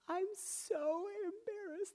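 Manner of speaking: put-on high, soft, drawn-out little-kid wail; tearful child. Five-year-old confesses to her dad through tears, imitated tearful child. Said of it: "through tears streaming down her face".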